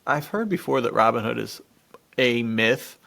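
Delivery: ordinary delivery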